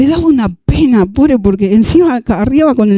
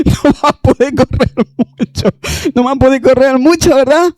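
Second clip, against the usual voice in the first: amused joking; 4 semitones higher than usual. Real, not acted. Joking about running pantsless.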